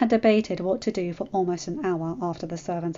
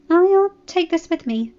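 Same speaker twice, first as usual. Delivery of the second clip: put-on high, earnest girl; girl insisting politely; young girl voice; 9 semitones higher, 7 dB louder than usual. A narrator's reading. Sansa voiced.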